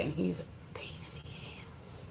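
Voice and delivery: voiceless, very soft grumble; hushed, exasperated whisper; whispered aside. Real, not acted. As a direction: whisper it like a confidential gripe. Aside about the dog.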